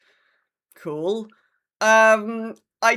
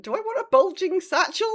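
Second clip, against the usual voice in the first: amused disbelief; 12 semitones higher than usual. Real, not acted. Amused disbelief at patch note.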